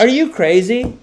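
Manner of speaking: can't believe what he just heard; incredulous outburst. Real, not acted. Host reacting to podcast regulation news, real disbelief.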